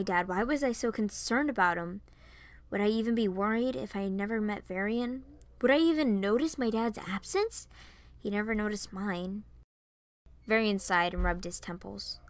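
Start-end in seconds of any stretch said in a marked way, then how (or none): none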